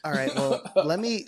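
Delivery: ordinary talk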